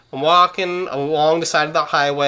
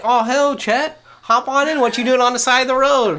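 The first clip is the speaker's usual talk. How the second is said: higher, cheery country pickup driver; a lightly voiced good-old-boy driver calling out; friendly driver voice; 8 semitones higher than usual. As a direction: voice the friend offering a lift, raised and hearty.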